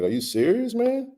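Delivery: high, incredulous question; shocked disbelief. Retold or imitated. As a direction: retell the moment the news hit you. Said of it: "I was like".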